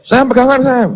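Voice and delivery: high-pitched mock yell; retold warning call. Father calling to his dozing son, high retold warning. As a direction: imitate an urgent call without real volume.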